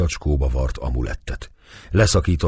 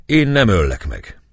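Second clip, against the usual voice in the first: ordinary delivery, nothing marked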